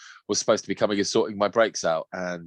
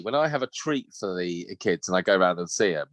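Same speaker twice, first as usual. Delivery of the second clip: usual speaking voice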